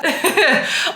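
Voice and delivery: high tearful laugh that breaks out; laughing to stop a real cry; a choked laugh on the edge of tears; teary laugh. Real, not acted. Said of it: "I do not want to ruin my makeup".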